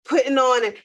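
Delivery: usual speaking voice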